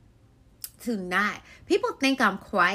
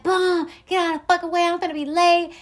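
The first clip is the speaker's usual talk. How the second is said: road rage yell; 9 semitones higher, 8 dB louder than usual. Retold or imitated. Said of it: "and I'm like".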